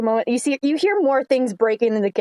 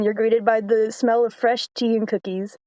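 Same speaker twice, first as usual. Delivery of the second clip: ordinary talk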